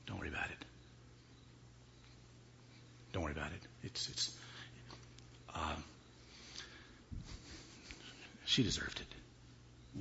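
husband calmly confessing to his new wife; chilling murmur. Acted. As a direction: murmur it like he means no harm. Husband reveals killing wife.

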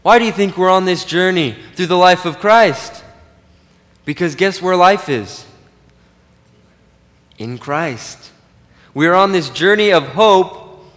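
ordinary talk

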